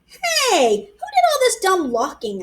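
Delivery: put-on indignant child. A narrator's reading. Child indignant at locked doors.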